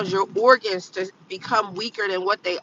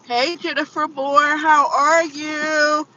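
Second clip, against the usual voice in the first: excited greeting; 7 semitones higher, 8 dB louder, slower than usual. Real, not acted. Greeting a viewer.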